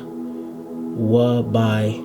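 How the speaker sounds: ordinary talk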